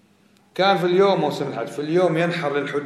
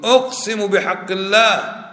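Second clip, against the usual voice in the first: high, stretched-out oath; swearing an oath; 6 semitones higher, slower than usual. Acted. Teacher repeats what some people say, emphatic drawn-out oath. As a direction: proclaim the oath slowly, pitched up. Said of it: "بعض الناس يقول".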